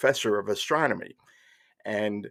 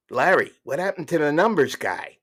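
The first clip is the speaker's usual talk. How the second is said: higher, put-on caller voice; radio caller; 5 semitones higher, 4 dB louder than usual. Caller asking Larry King on open phones, put-on voice. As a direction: play a curious phone-in caller, pitched up.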